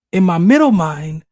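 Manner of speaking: normal talking voice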